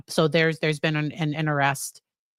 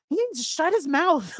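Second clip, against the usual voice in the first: mock yell; 11 semitones higher than usual. Prosecution objecting about a witness, high mock yell. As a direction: pretend to yell in exasperation. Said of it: "I think the prosecution's gonna yell".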